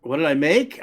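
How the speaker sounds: usual speaking voice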